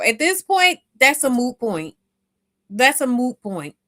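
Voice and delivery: loud, insistent, slammed down; emphatic. Real, not acted. Host fed up with excuses, loud emphatic insistence. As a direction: hammer the point home. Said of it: "It doesn't even matter".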